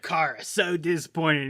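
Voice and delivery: put-on slow disappointed Boston accent; a disappointed dad in a Boston accent. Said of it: "in his Boston accent".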